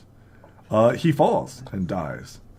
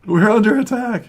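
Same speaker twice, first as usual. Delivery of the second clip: higher and louder, a bandit raising the alarm; alarmed cry; 10 semitones higher, 10 dB louder than usual. Acted. GM voicing a bandit warning the others, loud cry. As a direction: shout the warning to the camp. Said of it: "He cries out like".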